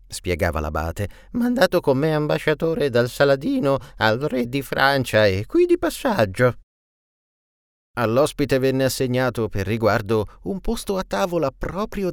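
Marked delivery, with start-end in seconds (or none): 1.3-6.6 higher, louder character voice; lifted, projecting voice of an abbot; abbot explaining. A narrator's reading. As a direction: announce the guest to the whole table. Abbot explains guest aloud to company.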